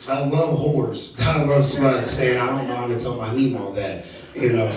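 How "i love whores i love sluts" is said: drawn-out slow confession, louder; slow hammed-up admission; slow deadpan. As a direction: savour each word slowly. Blurted confession to therapist.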